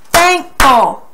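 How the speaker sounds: "Thankful" in two firm, separate beats; syllable by syllable. Shown as a demonstration. Teacher demonstrating two claps, "Thankful" split into two beats. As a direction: punch both syllables of "Thankful". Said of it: "clap every time you say a syllable".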